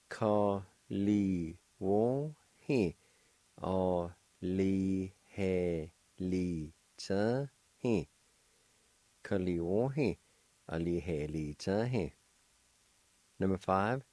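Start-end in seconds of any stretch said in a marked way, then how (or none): 0.1-8.1 "Ka li wo he" "O li he li cha he" said very slowly, each syllable apart; slow syllable-by-syllable demo. Shown as a demonstration. Teacher slowly models delighted for students. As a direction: spell it out slowly with your voice. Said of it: "And together".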